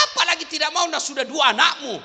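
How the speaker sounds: loud, high preaching shout; loud rebuke to the wives. Real, not acted.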